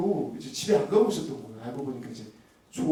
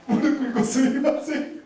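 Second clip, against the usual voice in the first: higher, slow, laughing 스미마셍 with 무릎 stressed; hammed-up apology; 10 semitones higher, 5 dB louder, slower than usual. Husband begging wife's forgiveness, hammed-up. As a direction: grovel comically on your knees. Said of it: "사과했습니다".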